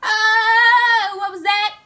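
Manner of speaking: high, loud panic at a shark; retelling her inner panic at a shark, high and loud; panicked inner voice. Retold or imitated. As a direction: shriek it out in sudden terror. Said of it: "I'm in my mind, I'm like".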